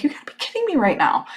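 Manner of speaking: high-pitched, quick disbelief; imitating her own stunned reaction; incredulous. She retells hearing the dealer's offer, incredulous.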